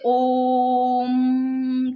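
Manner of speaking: "ओम" with the ओ stretched long; drawn-out om. Shown as a demonstration. Teacher stretches "ओम" to show a long vowel. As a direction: hold the ओ of "ओम" long, like a chant. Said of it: "कितना ही बोला जा सकता है ना ऐसे".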